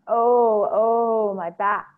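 loud, high moaned "oh"s, drawn out; acted wail of an aching underworld queen; crying out in pain. Ereshkigal moans over her back, crying out in pain. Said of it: "Ereshkigal cried out".

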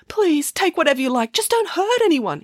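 high, anxious begging; pleading. Acted. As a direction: plead with the robber in rising pitch. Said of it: "he pleaded".

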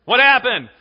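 high, loud eager cry; excited shout. Acted. Friends pressing for news, loud and thrilled. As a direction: demand the news with delight.

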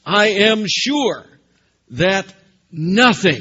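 slow and loud, leaning on sure and nothing; slow emphatic stress. Acted. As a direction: weigh each word heavily. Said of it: "do you see that word?".

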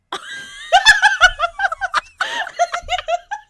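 big high laugh burst; loud laughing fit. Real, not acted. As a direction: crack up loudly.

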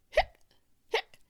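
two loud sharp hics; abrupt hiccup bursts. A narrator's reading. Boy still hiccuping, loud sharp hiccups. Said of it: "Hector still had the hiccups".

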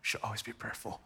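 low, quiet throwaway agreement; dutiful, barely-there murmur; offhand acknowledgement. Acted. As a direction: toss it off softly, like old news. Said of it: "we kinda read it like that".

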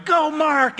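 high, loud cheer from the stands; imitated stadium cheer. Abraham in the stands cheering the preacher on, loud high cheer. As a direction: yell his name like a fan cheering him on. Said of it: "he was just spinning one in the air going".

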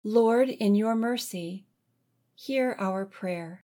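ordinary delivery

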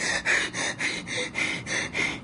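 imitated hyperventilating; rapid, shallow mock panic breaths; panicked breathing. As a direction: act out short gasps of a panic attack. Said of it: "your throat start closing up".

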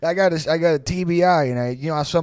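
hammed-up Jersey accent; old Jersey guy. Old man blaming brain injury, mock Jersey accent. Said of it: "I talk like this".

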